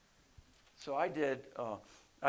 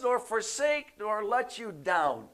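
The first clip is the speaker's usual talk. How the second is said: raised voice, easing as it ends; emphatic promise; 8 semitones higher, 5 dB louder, slower than usual. Acted. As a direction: preach it firmly, high and loud. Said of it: "he's emphatically saying that".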